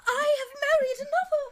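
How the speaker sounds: high voice breaking in a hammed-up sob; mock tearful confession. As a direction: wail it like a melodrama heroine. Bride confesses marriage, crying.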